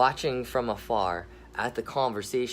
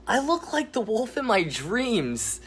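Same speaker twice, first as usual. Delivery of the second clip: put-on much higher, louder thrilled boy cry; excited shout; 11 semitones higher, 6 dB louder, slower than usual. Boy thrilled at becoming a wolf, high excited shout. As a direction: yell it like a delighted kid. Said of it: "Jeremiah shouts".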